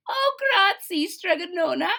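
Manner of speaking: very high, thrilled thanks; a bright girlish gasp of joy; delighted squeal. Acted. Pupil thanks her witch teacher, high and thrilled.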